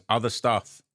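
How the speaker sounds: quoted reply with a touch of menace; mildly ominous. Retold or imitated. Narrator quotes the guy's cryptic reply to viewers, mildly ominous. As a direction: read it out with understated ominous weight. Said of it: "the guy just very ominously says".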